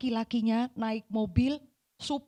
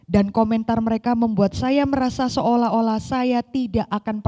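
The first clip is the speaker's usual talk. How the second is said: louder, measured reading from a book; reading aloud; 8 dB louder than usual. Acted. Preacher reads a writer's testimony to the congregation, measured reading voice. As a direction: read the passage out to the congregation.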